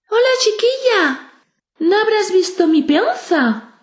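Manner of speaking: put-on high little boy voice; child voice. A narrator's reading. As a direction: speak like a small boy. Child character Pedrito speaks.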